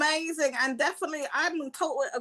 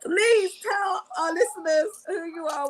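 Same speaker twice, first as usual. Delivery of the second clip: posh voice; 2 semitones higher, 4 dB louder than usual. Pretend. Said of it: "I get into my posh voice".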